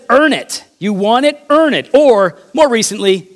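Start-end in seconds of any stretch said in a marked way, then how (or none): 0.1-1.9 mild mock of a stern demand, pushing "earn" up; a hint of a taskmaster barking "Earn it"; the world demanding. Preacher voicing the world's demand to the congregation, mildly stern.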